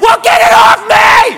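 high, loud, frantic shout; acted freak-out, yelling for help; panicked yell. Boyfriend thinks something crawled inside him, frantic high yell. Panicked man begs girlfriend.

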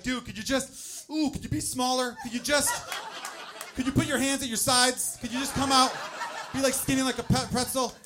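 high, loud, pleading instructions; anxious coaching. Acted. Dad coaching baby on the way out, anxious coaching. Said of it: "coaching him on the way out".

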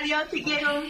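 put-on playful babyish high-pitched farewell; cutesy high voice. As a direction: coo it like sweet baby talk. Despedida cariñosa entre amigas. (voz aniñada y cariñosa de despedida)

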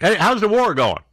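mock heckler. Host voices heckling reporter.

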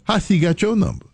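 high, lightly incredulous retelling; mock surprise. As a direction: act shocked on the phone. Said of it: "I said".

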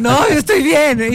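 hammed-up daughter telling mom she's fine; mock reassurance. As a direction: drag it out sweetly to calm mom. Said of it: "Y yo le decía a mi mamá".

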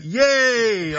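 high, drawn-out mock hooray; mock cheer. Acting out a silent sign's cheer.

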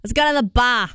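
put-on Boston accent, bar with no R. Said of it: "Boston people can't say their R's".

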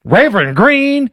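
mock Southern church accent; Hillary. Host mocks a candidate at church, fake Southern accent. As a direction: imitate a put-on Southern twang. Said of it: "Hillary doesn't sound like that".

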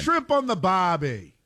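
slow, high Australian cliché; slow, hammed-up Australian accent. Continuing the Australian accent bit.

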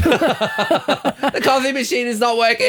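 high, slow, shouted complaint; whiny mock demand. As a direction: wail it like a spoiled diva. Imagining a pampered person demanding things.

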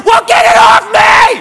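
high, loud and slow yell; panicking boyfriend yelling at his girlfriend; panicked shout. Retold or imitated. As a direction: beg her to get it off. Panicked demand.

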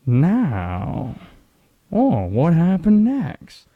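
drawn-out Dateline narrator with swooping pitch; hammed-up suspense-show host; Keith Morrison. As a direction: stretch each word into a loud, theatrical true-crime tease. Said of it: "When Bill Hader's playing Keith Morrison".

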